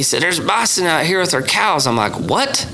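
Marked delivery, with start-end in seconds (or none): 0.2-1.9 high-pitched imitation of a funny rural accent; uncle's funny accent. Uncle reporting a stray bison, imitated funny accent. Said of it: "He's got this funny accent". 2.2-2.7 high, rising incredulous what; mock disbelief. Nephew hearing uncle's bison news, acted disbelief. Retold baffled reply to uncle.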